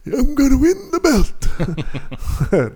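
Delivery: high, slow, laughing fighter boast; gruff punchy boasting. Retold or imitated. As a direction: brag like a cocky boxer. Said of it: "Sound like a boxer".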